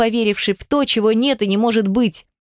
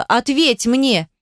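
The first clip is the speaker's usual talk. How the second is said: higher, drawn-out demand; mild shout; 4 semitones higher, much slower than usual. A narrator's reading. Heroine demands Demyan answer her, insistent cry. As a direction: insist with a stretched, raised-pitch cry. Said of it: "закричала".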